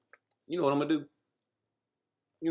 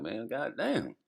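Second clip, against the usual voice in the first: high mocking voice; 13 semitones higher than usual. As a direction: voice jumps way up, incredulous. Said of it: "they wondering like".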